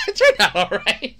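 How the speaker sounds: words breaking into laughter; laughing. Real, not acted. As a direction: crack up while insisting on it. Laughs at own claim.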